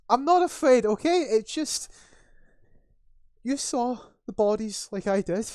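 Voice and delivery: high, loud defensive outburst; frightened guard. Acted. Sniffling guard defends himself to his colleague, panicky. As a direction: insist shrilly that you are fine. Tearful guard denies being afraid.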